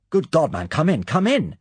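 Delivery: high, loud alarmed welcome; startled. A narrator's reading. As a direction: urgently wave him inside. Muir greets battered Bond.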